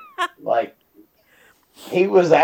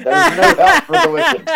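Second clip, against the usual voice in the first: higher mock quote, laughing through it; imitating cousin; 10 semitones higher than usual. Friend quoting cousin, higher and laughing. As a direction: repeat the cousin's words pitched up, giggling. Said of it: "that's what your own cousin said".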